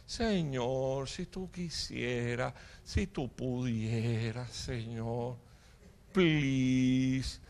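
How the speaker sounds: slow, soft, feeble pleading; mock pious prayer. People whose prayers never pierce the roof, mock pious. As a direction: drag out a limp, over-holy plea. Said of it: "tan santas que ni atraviesan el techo".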